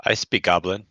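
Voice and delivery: normal talking voice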